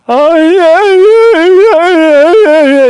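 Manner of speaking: high, loud whining yeahs; mock whining. Mocking officials whining again, high loud whine. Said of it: "they start whining again".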